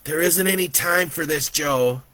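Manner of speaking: put-on impatient child voice; impatient boy. A narrator's reading. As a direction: scold him as an impatient little boy. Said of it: "The boy was getting impatient".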